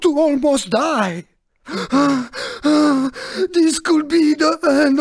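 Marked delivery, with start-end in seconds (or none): 1.7-3.5 heavy gasping breaths in fright; theatrical shocked panting; panicked gasps. Acted. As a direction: gasp hard after a near miss. Racer after a near death.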